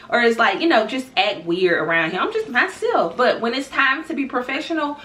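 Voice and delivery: ordinary delivery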